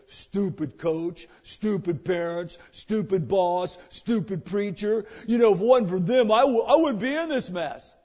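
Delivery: sulky blame-shifter whining; mock blaming whine. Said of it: "Not what we hear today".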